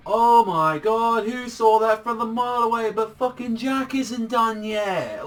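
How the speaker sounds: high, loud, drawn-out exclamation; mock amazement. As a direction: exclaim in exaggerated shock, stretching the words. Mock amazement at predictable twist.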